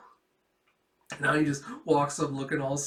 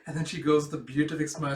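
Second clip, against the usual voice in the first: usual speaking voice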